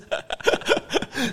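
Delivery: airy high laugh, quiet; breathy laugh. Real, not acted. Guide laughing at her own joke about paying again.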